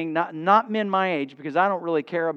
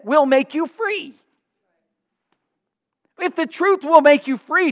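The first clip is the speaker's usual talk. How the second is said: raised, emphatic sermon delivery; higher-pitched, insistent pulpit proclamation; forceful preaching; 6 semitones higher, 5 dB louder than usual. Real, not acted. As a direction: preach it high and driving.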